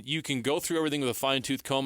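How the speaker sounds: normal talking voice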